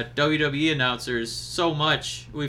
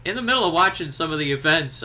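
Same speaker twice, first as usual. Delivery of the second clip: ordinary talk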